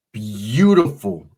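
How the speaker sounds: very slow, drawn-out admiration; savoring it. Acted. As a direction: stretch every word like she's gliding in. Describing Melanie's entrance.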